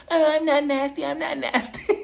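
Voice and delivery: very high-pitched taunting imitation; mocking mimicry. [name] making fun of Lisa's voice, mocking mimicry. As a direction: mimic her in a silly, squeaky voice. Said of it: "mimicking her voice like".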